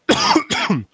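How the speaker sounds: sudden cough from swallowing wrong; real choking cough, caught off guard; choking on a drink. Real, not acted. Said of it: "Oh, ich hab mich verschluckt".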